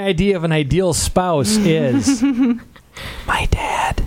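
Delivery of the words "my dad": unvoiced whisper. Real, not acted. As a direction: breathe the words, no voice. Whispered answer prompt.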